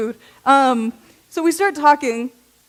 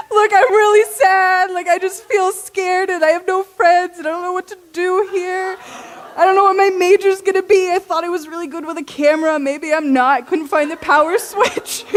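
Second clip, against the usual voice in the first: tearful pleading; 6 semitones higher, 4 dB louder than usual. Retold or imitated. Student tells a kind stranger she has no friends, near tears. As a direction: pour out your heart to a stranger, close to tears. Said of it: "I started crying".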